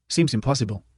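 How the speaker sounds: ordinary delivery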